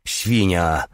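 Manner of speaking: plain reading voice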